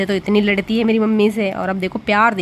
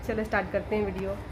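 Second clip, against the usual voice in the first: much softer and fast, half to herself; quick quiet aside; 10 dB quieter, faster than usual. Real, not acted. Vlogger to herself before starting the album, quick soft mutter. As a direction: mumble it quickly under the breath.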